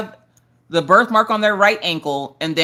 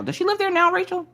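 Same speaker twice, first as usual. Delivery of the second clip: high question. Real, not acted. Asking a viewer in chat.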